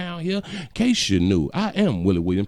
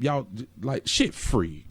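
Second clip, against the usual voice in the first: ordinary talk, nothing marked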